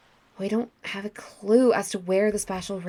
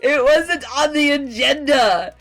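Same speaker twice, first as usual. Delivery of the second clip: raised, loud exasperated apology; higher, protesting shout; defensive yelling; 6 semitones higher, 7 dB louder, slower than usual. Acted. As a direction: yell excuses back at an accuser. Yells excuses back at Palkia.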